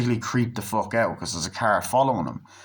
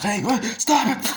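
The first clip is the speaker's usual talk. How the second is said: panicked yell; 12 semitones higher than usual. Retold or imitated. Attacked man yells at the masked kidnapper, much higher. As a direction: cry out as he grabs you.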